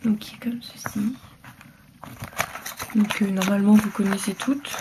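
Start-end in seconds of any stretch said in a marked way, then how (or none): 0.0-1.1 breathy whisper to herself; whispered aside. Real, not acted. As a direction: drop to a whisper while working. Muttering while applying base. (chuchoté en aparté)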